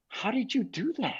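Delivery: high, hushed imitation of amazement; softly marvel, voice lifted high; amazed question. Artist to mixer after the playback, retold amazed.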